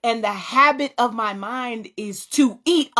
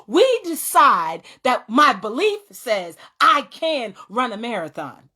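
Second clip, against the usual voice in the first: ordinary delivery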